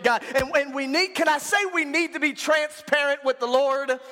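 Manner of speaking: high, driving pulpit exhortation; preaching at full force. Real, not acted. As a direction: press the congregation hard with each phrase.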